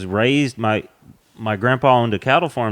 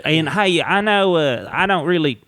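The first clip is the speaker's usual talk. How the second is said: thickened Southern accent; 5 semitones higher than usual. Shown as a demonstration. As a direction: lay the Southern accent on thick to show the stage voice. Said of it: "this ain't my real accent".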